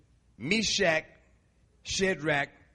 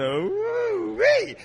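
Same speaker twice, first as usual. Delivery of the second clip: high, loud woo-wee of delight; a high, admiring whoop; excited woo; 6 dB louder than usual. Retold or imitated. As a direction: whoop at a pretty woman. Pretty lady walks up.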